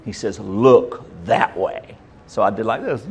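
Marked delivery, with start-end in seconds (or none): 0.4-2.0 slow stern stepfather. Retold or imitated.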